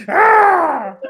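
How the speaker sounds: high loud mock cry of pain; mock scream. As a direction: yelp as if eyes burn. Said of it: "she's like".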